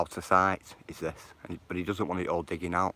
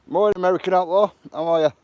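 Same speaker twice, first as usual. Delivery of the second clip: loud greeting; 11 semitones higher, 12 dB louder, faster than usual. Real, not acted. Greeting live chat, calling out.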